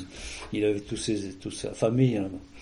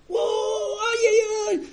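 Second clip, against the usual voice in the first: high mock yelp of pain; retold cry of pain; much slower than usual. Wife falls on the way out, imitated high pain cry. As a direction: imitate a woman crying out after a fall. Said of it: "j'entends gueuler".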